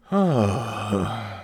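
long exhale after ranting; long sigh. Real, not acted. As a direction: let out a spent sigh. Recovering after the rant.

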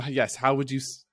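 normal talking voice

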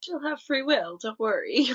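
high, sing-song mock comfort; soothing them falsely, tongue in cheek; mock reassurance. Mocking the preacher's reassurance.

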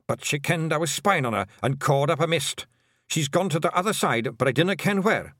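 a wee blue man reporting, all Scots burr; Scottish pixie. Acted. Hamish warns Tiffany she crossed over, Scottish pixie voice.

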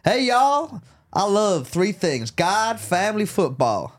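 high, slow Southern drawl; southern accent. Shown as a demonstration. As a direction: put on a hammy Southern accent. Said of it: "I got a southern one".

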